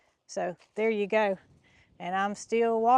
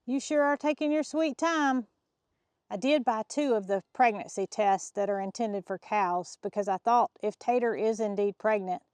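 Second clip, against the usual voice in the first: ordinary delivery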